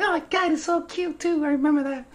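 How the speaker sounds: put-on high, soft, gushing girl voice; mock girly gush. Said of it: "She's like".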